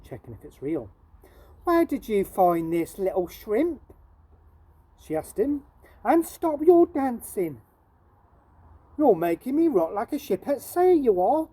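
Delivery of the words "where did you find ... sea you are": scolding shopkeeper. Acted. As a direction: tell the little shrimp off.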